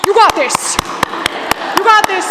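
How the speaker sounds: cheering loudly at a rally; enthusiastic yelling. Retold or imitated. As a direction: yell like an overexcited fan in the crowd. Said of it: "He was literally yelling".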